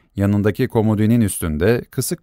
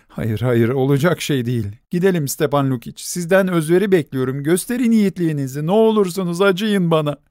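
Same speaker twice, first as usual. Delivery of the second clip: building from plain to high begging; rising plea; 6 semitones higher than usual. A narrator's reading. Nelli begging Stepan Lukic, building to high. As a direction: climb into desperation.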